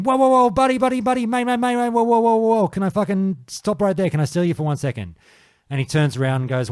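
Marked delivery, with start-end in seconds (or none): 0.0-5.2 flag down a stranger, voice way up; shouting after a passing mage to stop him; calling out. Retold or imitated. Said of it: "I yelled out at him".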